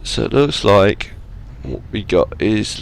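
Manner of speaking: normal talking voice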